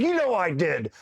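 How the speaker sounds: high-pitched mock protest; indignant retort, imitated. Buddy retorts in acted argument.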